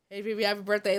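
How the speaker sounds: usual speaking voice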